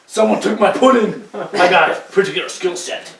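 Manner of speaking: mock old action hero. Friend jokes about Taken sequel, put-on old hero. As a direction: play a cranky old hero losing his pudding. Old Liam Neeson in nursing home.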